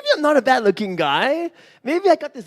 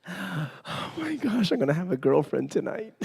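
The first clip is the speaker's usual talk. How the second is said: softer, slowed, half-laughing to himself; giddy inner thought; 6 semitones lower, 9 dB quieter than usual. Retold or imitated. Guy thinking he'll have a girlfriend, giggling softly. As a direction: giggle it quietly to yourself.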